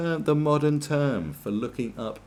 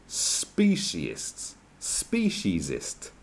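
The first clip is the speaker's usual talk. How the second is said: slow sneer; 5 semitones lower, much slower than usual. Real, not acted. Sneering at the new word.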